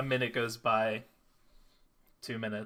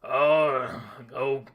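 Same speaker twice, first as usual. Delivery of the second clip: deeper voice; 6 dB louder, slower than usual. Acted. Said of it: "a deeper voice saying".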